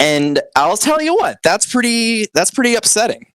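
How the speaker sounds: high, whiny mock hurt; mock wounded. Scolding the listeners, hammed-up high wounded whine.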